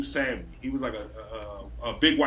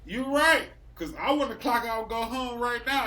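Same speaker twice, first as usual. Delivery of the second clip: eager agreement; 7 semitones higher than usual. Retold or imitated. Boss agreeing, eager to leave.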